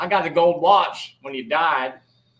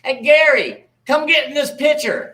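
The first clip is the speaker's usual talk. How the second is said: calling out; 7 semitones higher, 7 dB louder than usual. Acted. Calling cousin over.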